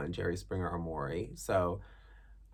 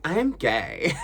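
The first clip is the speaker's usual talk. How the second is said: higher, louder hair-flipping declaration; dramatic cheerleader; 6 semitones higher, 8 dB louder, slower than usual. Retold or imitated. Teen in cheerleading uniform coming out to mom, louder diva flair. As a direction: announce it like a diva. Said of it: "I'm flipping my hair".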